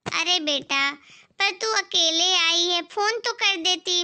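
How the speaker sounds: ordinary talk, nothing marked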